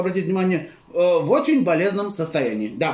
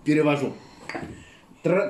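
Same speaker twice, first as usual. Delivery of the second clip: normal talking voice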